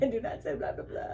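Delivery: laughing. Retold or imitated. Mock vampire line, laughing.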